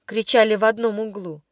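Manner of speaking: ordinary delivery, nothing marked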